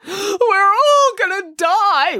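loud, very high, drawn-out cry; wailing. Acted. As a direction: wail slowly in hammed-up despair. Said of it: "wailed Boris".

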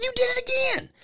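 very high, quiet mock; high squeaky voice. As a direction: squeak it out in disbelief. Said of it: "I said".

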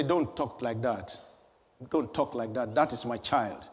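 softly voiced God defending his child; gentle rebuke. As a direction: hush them tenderly, like a father guarding a child.

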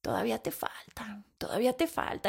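susurro burlón de quien sermonea; mock whisper. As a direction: imita al que te presiona, en voz baja. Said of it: "alguien más llegue y me empiece a decir". (susurro condescendiente y burlón)

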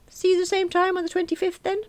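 high genial man voice; breezy solicitor; lightly voiced young boss. Young Davis farewell.

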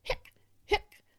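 two sharp "hic" bursts; abrupt acted hiccups. A narrator's reading. Boy with hiccups before movie, sharp hiccups. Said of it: "Hector has the hiccups".

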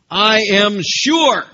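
a preacher voicing Paul's certainty loudly; emphatic declaration. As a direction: proclaim it with total conviction. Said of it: "Is that a strong word?".

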